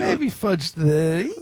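slow, high teasing; high mock hedge. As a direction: stretch it out in a silly high voice. Joining the squeaky bit.

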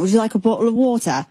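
put-on quick, fuller English accent, offering a drink. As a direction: imitate an English rocker kindly offering water. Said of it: "in his accent".

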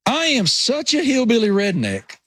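put-on hillbilly accent. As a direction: lay on the Appalachian accent. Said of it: "how thick my, you know, rural Appalachian accent".

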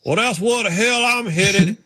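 slow, high Virginia drawl; deadpan country punchline; Ward Burton impression. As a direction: driver heading for the cooler, drawling. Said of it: "He goes".

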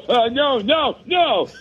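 high, panicky little no's; petrified. Retold or imitated. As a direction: squeak it out, terrified. Frozen kid facing tackle.

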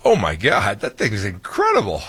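acting out a wowed reaction; mock-awed exclamation; retold amazement. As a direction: relive being blown away by it. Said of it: "And I'm like".

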